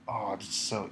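ordinary talk, nothing marked

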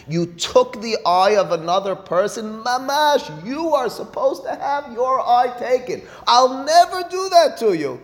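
high, slow, drawn-out pronouncement; emphatic warning. Acted. Teacher addressing one who took an eye, emphatic warning.

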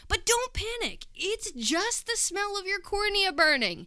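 high, slow, mock-soothing doctor voice; doctor cheerfully reassuring the patient, high and slow; mock calm doctor. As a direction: play the doctor calmly telling her not to panic. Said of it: "I remember the doctor saying".

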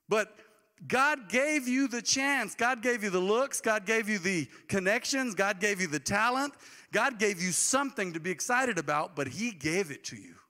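slow, rising, emphatic sermon; fervent preacher build; preaching with fire. Acted. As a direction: build it like a pulpit crescendo. Preaching climax.

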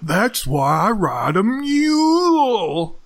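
high, very slow drawl with a long held note; hammed-up, high-flying movie quote; drawn-out movie line. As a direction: stretch the last word way out, pitched high.

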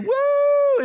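high, loud, held "woo"; excited whoop. Pretend. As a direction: let out a long mock-celebratory whoop. Mock whoop at an easy task.

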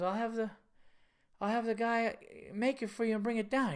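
a confused Italian owner explaining the obvious; Italian owner impression. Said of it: "it was so Italian and funny".

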